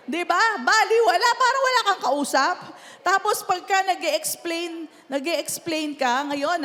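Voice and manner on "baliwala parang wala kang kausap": loud, higher exasperated cry; loud exasperated complaint. Acted. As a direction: throw up your hands, nobody listens. Exasperated about kids ignoring.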